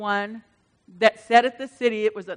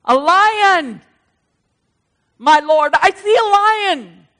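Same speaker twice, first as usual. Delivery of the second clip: crying out; 6 semitones higher, 7 dB louder, slower than usual. Acted. Preacher cries out vision.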